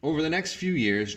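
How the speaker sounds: ordinary delivery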